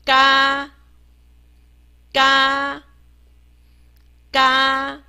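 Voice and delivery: 嘎 as a long, level "gah"; 字母发音示范. Shown as a demonstration. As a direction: hold the open vowel of 嘎 steady. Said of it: "我们念一下". (平稳拉长地念“嘎”)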